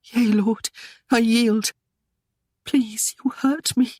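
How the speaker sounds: high, trembling plea of surrender; frightened plea. A narrator's reading. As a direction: beg him in fear, voice rising. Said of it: "could only whisper hoarsely".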